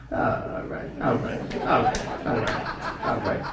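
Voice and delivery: hushed, trailing mumble; very soft embarrassed muttering; sheepish acceptance. Acted. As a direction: back down softly, word by word. Sheepish answer after misunderstanding.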